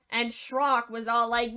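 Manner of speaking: ordinary delivery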